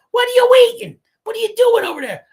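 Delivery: mom yelling. Retold or imitated. Wife catching husband with a cannoli, high mock yell. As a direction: shriek at him like a wife catching him out.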